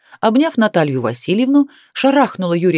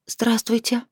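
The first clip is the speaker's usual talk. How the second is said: higher, softer shy hello; bashful greeting; 5 semitones higher, 5 dB quieter, slower than usual. A narrator's reading. Girl greets mother's acquaintances, bashful. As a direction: say it timidly, blushing. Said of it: "сказала Яночка и покраснела".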